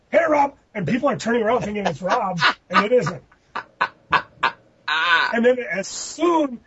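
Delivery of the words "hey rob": high, loud imitation holler; comic high-pitched shout; mock yell.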